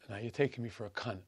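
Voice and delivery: low, very soft aside; muttered disbelief. Retold or imitated. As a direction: drop it right down, almost under the breath. Retold reaction, said under the breath.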